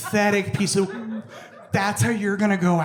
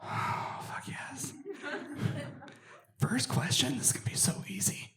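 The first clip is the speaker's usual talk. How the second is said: whispered aside; 5 semitones higher, 13 dB quieter than usual. Retold or imitated. Inner thoughts whispered aside.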